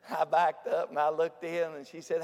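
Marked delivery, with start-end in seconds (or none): none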